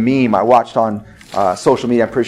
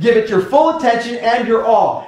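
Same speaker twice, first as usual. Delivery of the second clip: higher, emphatic sermon drive; fervent pulpit exhortation; preaching at full force; 11 semitones higher than usual. Acted. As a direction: press the point home to the congregation. Preaches on to-do priorities.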